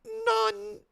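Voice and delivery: squeaky high-pitched overexcited yell; gushing, squealing fan voice gone high and loud; voice shooting way up. Real, not acted. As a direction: voice jumps into a loud, cracking falsetto. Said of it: "Why is my voice like this?".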